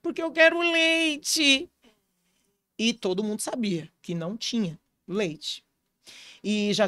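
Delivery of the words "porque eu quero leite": put-on high, drawn-out toddler whine; crying small child. Hungry little brother answers his father, imitated high tearful whine. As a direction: imitate a little boy wailing slowly on a high pitch. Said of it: "ele não para de chorar".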